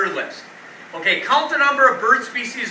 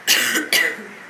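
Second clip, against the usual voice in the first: chesty cough; 2 semitones higher than usual. Real, not acted. Cough mid-lesson.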